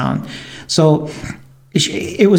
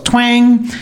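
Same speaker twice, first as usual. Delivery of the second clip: high, ringing twang; imitated bowstring twang; 7 semitones higher than usual. Hunter telling of the arrow's release, imitated twang. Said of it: "As soon as I let the arrow go, it goes".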